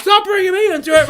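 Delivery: high, loud shouted protest; indignant imitated shout; mock squabbling. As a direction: snap back in a hammed-up argument. Acting cooks' quarrel.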